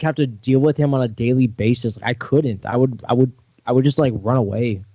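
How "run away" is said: normal talking voice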